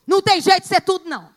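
usual speaking voice

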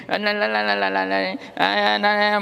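singsong la-la babble; imitated meaningless chatter. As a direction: mimic speech heard as pure sound, on an even pitch. Giả tiếng nghe không hiểu nghĩa. (giả tiếng líu lo không nghĩa)

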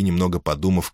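usual speaking voice

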